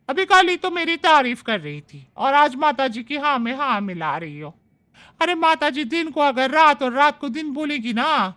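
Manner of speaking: chatty, self-praising house maid; maid's voice. Acted. Maid Manju defends her work to Shobhna, chatty and boastful. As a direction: boast about your own work.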